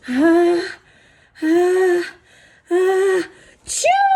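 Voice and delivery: building sneeze ah ah ah then chew high; big bear sneeze. Acted.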